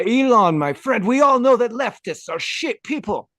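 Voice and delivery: high, loud, fiery Argentine politician; Javier Milei impression. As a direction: rant like a fired-up Milei. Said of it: "he says".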